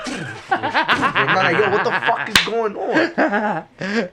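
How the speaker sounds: slow, high, laughing question. Real, not acted. Retelling the flat, laughing slow.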